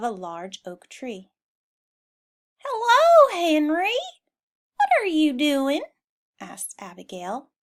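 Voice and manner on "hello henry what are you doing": much higher, loud friendly cow voice; higher, louder greeting; cheery cow greeting. Acted. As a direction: call out happily like a cow.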